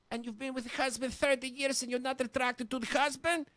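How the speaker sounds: usual speaking voice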